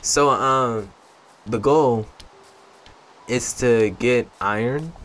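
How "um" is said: ordinary talk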